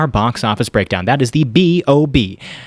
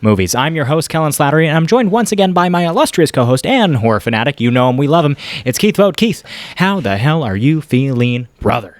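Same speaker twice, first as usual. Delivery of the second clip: higher, upbeat announcer voice; show host intro; 4 semitones higher than usual. Acted. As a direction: welcome the audience like a host. Podcast host opens the show.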